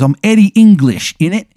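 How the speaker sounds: loud, high mock English punk; unconvincing English accent. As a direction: announce yourself in hammed-up bad English. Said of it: "his accent isn't convincing at all".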